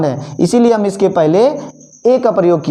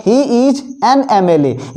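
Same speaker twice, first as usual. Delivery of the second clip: very slow, letters spaced out; slow classroom example; slow dictation; 2 semitones higher, much slower than usual. Shown as a demonstration. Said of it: "अगला है".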